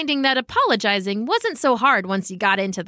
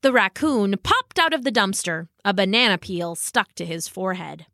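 plain reading voice

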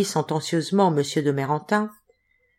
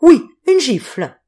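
higher, louder, slower furious insistence; a clerk boiling with rage, stressing oui; enraged; 7 semitones higher, 8 dB louder than usual. A narrator's reading. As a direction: spit out the word gifle in outrage. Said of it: "dans un extraordinaire état de rage".

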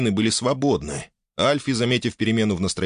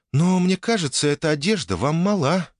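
embarrassed lady; 7 semitones higher than usual. Acted. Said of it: "несколько смущённо произнесла леди".

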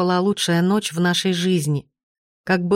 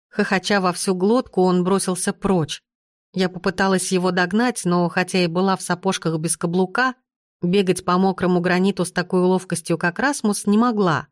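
usual speaking voice

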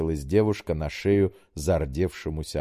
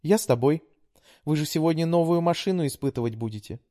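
put-on high, pleading young woman; daughter voice; 10 semitones higher than usual. A narrator's reading. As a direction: coax like a sweet daughter. Daughter voice held.

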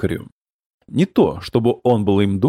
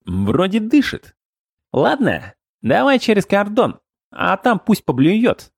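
squeaky high goblin chatter; 8 semitones higher than usual. A narrator's reading. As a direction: pitch it up like a small goblin. Goblin voiced in audiobook.

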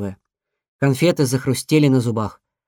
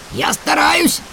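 yelling; 10 semitones higher, slower than usual. Acted. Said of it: "вопил в ответ бобёр".

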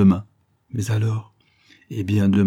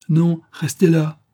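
firm command; 8 semitones higher, 6 dB louder, slower than usual. A narrator's reading. Broquet arrête Harry.

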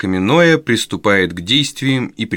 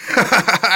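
a high burst of jeering laughter; mocking guffaw; 9 semitones higher than usual. A narrator's reading. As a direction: laugh right in his face. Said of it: "расхохотался Ной". (злорадный хохот)

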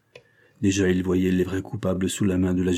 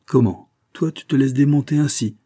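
loud, raised-pitch questioning; astonished reproach; 6 semitones higher, 5 dB louder than usual. A narrator's reading. Boss to his rattled helper, astonished. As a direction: challenge him in disbelief.

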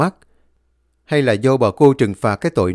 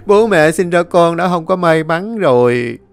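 loud wailing lament; 7 semitones higher, 4 dB louder than usual. A narrator's reading. Kiên lamenting his lost front teeth, hammed-up loud wailing. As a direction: bewail your fate to heaven and ancestors. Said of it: "Hắn la làng la xóm".

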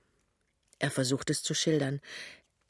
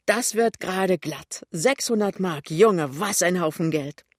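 louder, impressed exclaiming; delighted; 3 semitones higher, 7 dB louder than usual. Acted. Lämmchen adds up both savings, delighted and loud. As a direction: marvel at the sum with glee.

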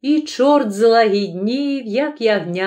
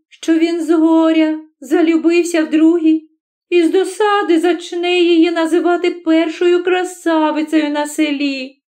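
put-on higher girlish musing; vain village beauty; 6 semitones higher than usual. Oksana reassuring herself the blacksmith loves her, higher coquettish musing. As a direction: think aloud as a self-assured coquette.

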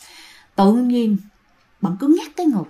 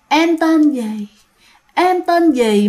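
slow, eerie ghostly question, higher; creepy ghost voice; 4 semitones higher, much slower than usual. Retold or imitated. Ghost asking the frightened girl her name, eerie and slow. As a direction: drawl it out like a ghost leaning over you. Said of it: "Mà cái giọng của nó rất ghê".

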